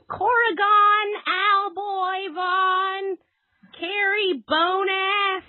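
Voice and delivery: high, very slow, drawn-out mocking roll call; shrill teacher voice. Imitating the data teacher calling roll, high and drawn out. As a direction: stretch each name out like a shrill teacher. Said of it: "this really shrill voice".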